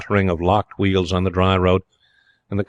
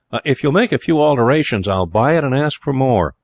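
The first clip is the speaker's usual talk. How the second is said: higher-pitched American offer; American accent; 6 semitones higher, 3 dB louder than usual. A narrator's reading. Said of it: "in a marked American accent".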